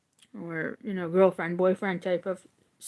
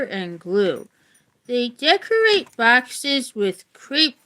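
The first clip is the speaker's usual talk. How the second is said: clear, measured book-reading voice; reading aloud; 4 semitones higher, 3 dB louder than usual. Acted. Reading a Valentine's history book to viewers, in an even read-aloud voice. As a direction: read it out like a class textbook.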